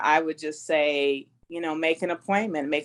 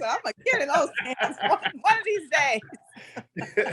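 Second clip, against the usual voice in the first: giggling out a cheeky quote, pitched up; laughing through words; 11 semitones higher than usual. Retold or imitated. Retelling her own cheeky line to the host, laughing.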